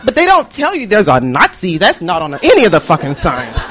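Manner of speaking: ordinary talk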